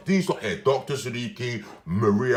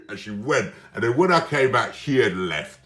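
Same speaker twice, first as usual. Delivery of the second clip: normal talking voice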